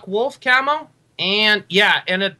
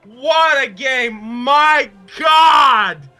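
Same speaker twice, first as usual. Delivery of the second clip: loud elated cheer; slow, delighted shouts; excited shouting; 6 semitones higher, 5 dB louder, much slower than usual. Real, not acted. Celebrating the finish.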